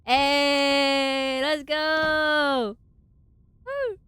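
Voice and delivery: loud high cheer. Real, not acted. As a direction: whoop it up.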